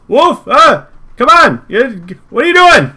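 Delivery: high, loud exasperated calling; annoyed coworkers. Acted. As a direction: yell at Wolf in high pitch. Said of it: "Peter and Goebel are like".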